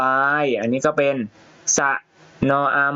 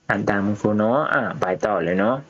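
ordinary talk, nothing marked

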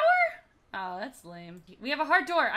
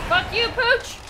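higher-pitched yell at an enemy dog; shouted taunt; 7 semitones higher, 4 dB louder than usual. Real, not acted. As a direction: curse out an attacking dog. Fighting game dog.

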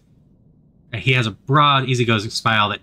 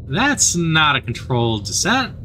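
slower, weighty delivery of "That's not a controlled descent"; slow ominous repeat; 4 semitones higher, slower than usual. Acted. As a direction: let each word land with dread. Slow dramatic repeat of line.

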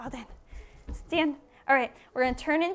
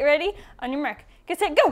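ordinary talk

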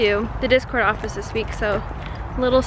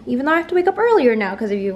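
ordinary delivery

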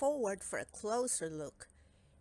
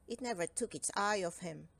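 ordinary delivery

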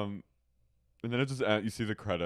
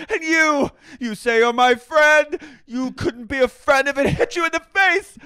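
high, loud hammed-up screaming; mock fury; 12 semitones higher, 11 dB louder than usual. Star turning on his friend Schwartz, mock screaming. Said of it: "and he goes".

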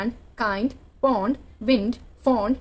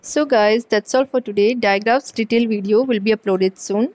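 louder, slow YouTube sign-off; channel outro; 7 dB louder, much slower than usual. Acted. Host signing off to kid viewers, loud and slow.

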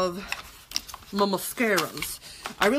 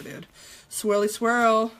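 ordinary talk, nothing marked

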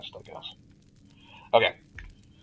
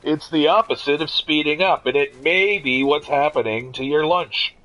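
a hint of a news anchor; deadpan broadcast read, slower; newscaster voice; 4 semitones higher than usual. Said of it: "one has to practice these things".

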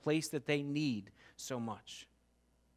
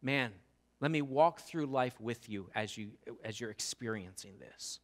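tender invitation; 7 semitones lower, 5 dB quieter than usual. Retold or imitated. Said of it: "Very different than".

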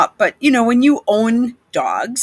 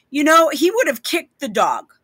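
ordinary delivery

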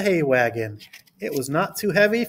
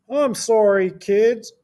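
higher-pitched apologetic farmer character; storybook farmer, pitched up; Farmer Brown; 6 semitones higher, slower than usual. A narrator's reading. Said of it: "said Farmer Brown".

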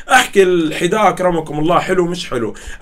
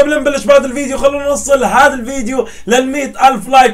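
loud, high, energetic YouTuber opening; hyped channel intro; 6 semitones higher, 4 dB louder than usual. Acted. YouTuber greeting his fans, asking for likes, loud and hyped. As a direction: pump up the viewers, big and bright.

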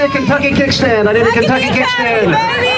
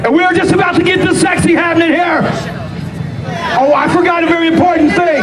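announcer hype; 5 semitones higher than usual. Acted. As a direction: hype the wrestling crowd.

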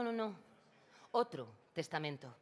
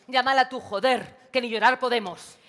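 full-volume yelling, higher pitched; furious shout; 5 semitones higher, 13 dB louder than usual. Acted. María screams at Ana to call their mother, furious. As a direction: blow up and scream at her. María explodes at her sister.

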